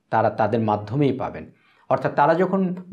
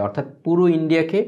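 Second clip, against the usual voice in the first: ordinary delivery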